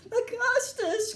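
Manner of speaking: high, drawn-out cry of his name; high agitated call. A narrator's reading. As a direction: cry out in alarm, stretching the name. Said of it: "cried Mister Wonka".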